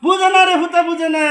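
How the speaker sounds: high, loud lament; high-pitched frustrated shouting; exasperated yell. Acted. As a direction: wail that the child won't understand. Exasperated parent yells at stubborn child.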